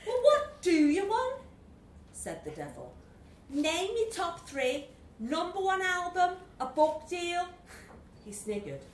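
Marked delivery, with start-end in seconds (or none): 0.1-1.4 loud, high Scouse challenge; Scouse accent. Acted. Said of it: "was a Scouser". 3.5-7.5 put-on Scouse accent. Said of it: "was a Scouser".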